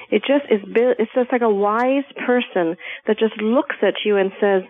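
ordinary delivery

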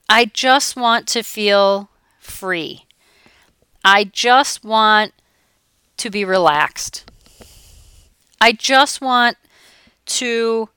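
slow, longing client voice; a hint of a yearning client; wistful client. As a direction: drag out each wish like a dreamy client. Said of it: "I'll have people say that to me".

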